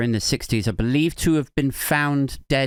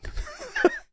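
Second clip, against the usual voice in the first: sudden high-pitched giggle; a high squeal of laughter; high squeaky laugh; 6 dB quieter than usual. Real, not acted. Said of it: "I don't know why I'm laughing".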